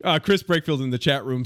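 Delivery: ordinary talk, nothing marked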